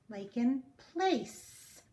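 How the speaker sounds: "place" said slowly, leaning on the S; soft S sound. Shown as a demonstration. Teacher demonstrating the soft C in "place". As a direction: model the S in "place". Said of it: "make the sound of S".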